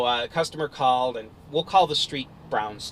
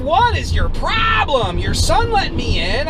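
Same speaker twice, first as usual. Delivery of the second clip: high, loud exasperated shout; yelling back; 13 semitones higher, 6 dB louder, slower than usual. Retold or imitated. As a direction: shout it in a heated argument. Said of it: "we're yelling back and forth".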